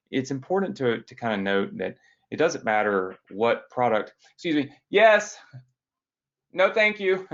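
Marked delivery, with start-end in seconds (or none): 4.3-7.3 high, loud call to the door. Real, not acted. Speaker to whoever keeps ringing the hotel doorbell, calling out. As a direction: shout a polite refusal across the room.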